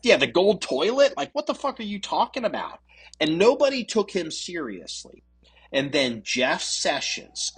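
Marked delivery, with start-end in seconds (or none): 0.0-2.8 high-pitched mock disbelief; acted-out exasperated question; incredulous. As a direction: play the baffled scoffer, pitched up. Acting public disbelief at candidate.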